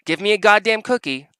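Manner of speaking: mock a spouse flashing rank; mocking entitled spouse. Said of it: "to go out there and say".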